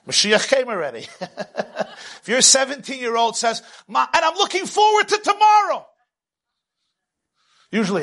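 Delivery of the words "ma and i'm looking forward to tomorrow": put-on 1 gushing teen. Happy teenager answers.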